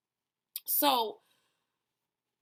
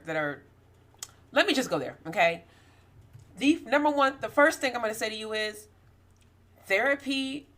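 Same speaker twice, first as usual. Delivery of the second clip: louder, emphatic preaching voice; 7 dB louder than usual. Acted. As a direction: preach it to the congregation. Preaching to viewers.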